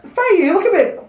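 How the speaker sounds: high mock motherly voice; bride's mum. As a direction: pipe up as a doubtful mother of the bride. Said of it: "the bride's mum's like".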